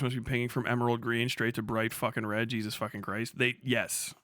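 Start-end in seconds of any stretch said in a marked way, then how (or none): none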